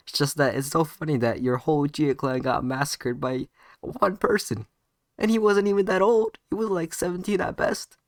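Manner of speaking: loud, climbing incredulous jeer; derisive mockery. Naruto mocking Sasuke over his fallen clan, derisively. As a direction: ridicule it, rising high and loud.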